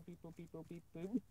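robot voice beeping; robot beeps. Pretend. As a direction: beep boop like a computer. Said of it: "hacking into my brain".

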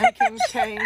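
bougie Kim K voice. Pretend. Acting bougie.

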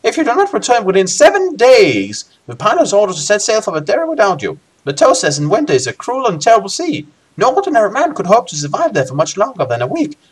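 high, swooping character voice; Baindon's voice. Acted. Guildmaster briefing Lone Wolf on the voyage, high put-on voice. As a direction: put on a high guildmaster voice with big pitch swings. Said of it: "says Baindon".

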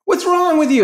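high, loud mocking kid voice. Kids mocking the streamer's bad play in a high, loud voice. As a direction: squeal it like an annoyed kid.

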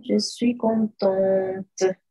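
slow, "contente" with the final T sounded; slow feminine form. Shown as a demonstration. Teacher contrasting both forms slowly. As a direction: draw out "je suis contente" and end on the T. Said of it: "Porque termina al final en E".

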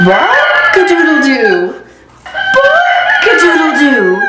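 slow, loud buck-a-doodle-doo crow; proud rooster crow. Shown as a demonstration. Rudy showing off his grown-up crow, slow and loud. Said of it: "my voice sounds more like this".

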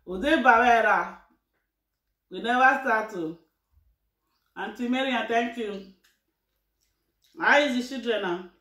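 eating mid-conversation; speaking between bites; talking while chewing. Real, not acted. Seen 3: Holds bowl, picks food by hand; hand-to-mouth just before words.

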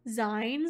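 "zines" with a long i, rhyming with lines; mistaken pronunciation. Real, not acted. Reading an unfamiliar word off the screen, "zines" with long i. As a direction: say "zines" like "zynes". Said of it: "I've always read it as zines".

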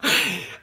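sudden real laugh; burst of laughter. Real, not acted. Vlogger cracking up at a memory, real laugh. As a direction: laugh at a private joke. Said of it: "what I'm laughing at".

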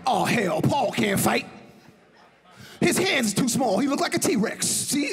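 mock his rival, hammed up; John's scornful bluster. John Witherspoon mocking Paul Mooney's small hands, loud laughing scoff. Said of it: "He be like".

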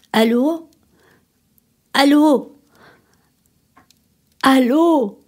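loud, rising calls into a dead phone line; desperate hello into the receiver; calling out. Acted.

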